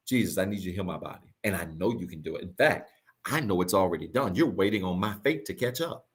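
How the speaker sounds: quiet, fast, sure prayer to Jesus; confident prayer. Pretend. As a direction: pray it like it's already done. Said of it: "You gotta start talking like that".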